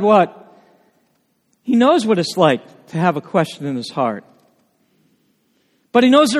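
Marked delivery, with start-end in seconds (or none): none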